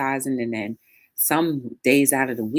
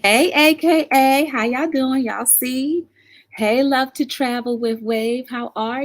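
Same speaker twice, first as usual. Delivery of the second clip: excited greeting; 9 semitones higher, 5 dB louder than usual. Real, not acted. Greeting viewers joining the live.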